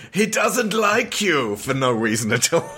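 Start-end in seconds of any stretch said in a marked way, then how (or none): 0.1-1.6 high, slow mock menace; gruff alien thug. Cantina thug threatening Luke for no reason, mock menace. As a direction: ham up a cantina thug picking a fight. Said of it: "turning up and going".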